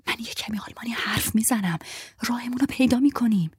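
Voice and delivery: mouthed whisper. Acted. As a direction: mouth it silently to her friend.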